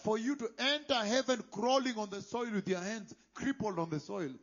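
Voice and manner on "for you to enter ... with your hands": loud, thundering pulpit warning, slowing on key words; preaching at full force. Acted. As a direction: preach it out with fire and wrath.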